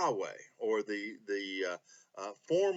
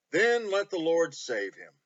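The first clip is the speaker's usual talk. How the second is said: earnest Bible reading with long pauses between verses; scripture reading; 5 semitones higher, 6 dB louder than usual. Acted. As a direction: read the psalm with weight and pauses. Reading the psalm.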